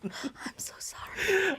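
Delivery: slow, soft whisper through giggles; breathless, drawn-out whisper; whispered apology. Real, not acted. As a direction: whisper it, helpless with laughter. Apologising while helpless with laughter.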